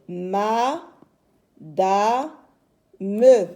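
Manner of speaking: "ma, da, me" in three separate beats; syllable by syllable. Shown as a demonstration. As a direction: stretch "ma" and "da" upward, land loud on "me". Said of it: "On a trois syllabes".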